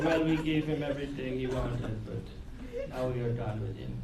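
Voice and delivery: put-on low, soft voice with a muddled Hungarian accent; vague Hungarian accent. The universe dismissing a wisher, quiet mock-Hungarian accent. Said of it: "Hungarian or whatever the hell that accent was".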